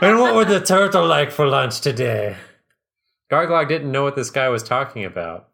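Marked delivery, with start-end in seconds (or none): 0.0-2.4 loud, high mock-French accent with laughter; booming fake French waiter, amused; French waiter character. A narrator's reading. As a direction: take the order as a hammed-up Frenchman. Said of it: "went back into character".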